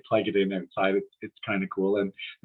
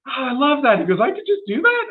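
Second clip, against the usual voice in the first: imitating the waiter's much higher, delighted squeal; delighted surprise; 6 dB louder than usual. Waiter thrilled by her advice, much higher excited voice. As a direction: echo his amazement, pitched way up. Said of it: "And he went".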